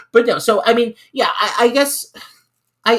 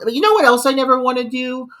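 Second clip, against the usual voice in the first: ordinary talk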